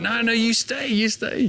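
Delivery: high, insistent mock locals; imitating insistent hosts. Imitating the partygoers keeping him in the room, high and insistent. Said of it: "they go".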